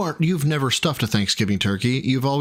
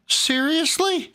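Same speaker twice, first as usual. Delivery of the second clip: much higher, slow, stretched seriously; stunned, dragging the word up high; incredulous; much slower than usual. Real, not acted. Friend reacts to the racist reading.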